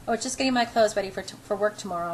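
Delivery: usual speaking voice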